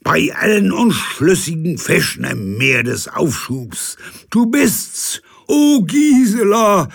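high, loud, grandiose sea captain; pompous captain. Acted. Captain theatrically questions Gisela, high and loud. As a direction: declaim it like an old salt.

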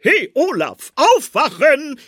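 hoch und laut rufen, um Olaf zu wecken; lauter Weckruf. A narrator's reading. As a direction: ruf ihn laut wach. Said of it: "rief der Weihnachtsmann". (laut rufend)

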